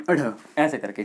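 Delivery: अढ़ with a short अ then a flicked ढ़; flapped अढ़. Shown as a demonstration. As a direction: demo the flap in अढ़, quick and light. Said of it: "हम इसे पढ़ते ही ऐसे हैं".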